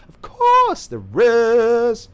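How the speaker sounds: very high, very loud, slowly stretched out; mock high wail. Vlogger answering his own question in a high, loud wail. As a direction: belt it out like a cartoon.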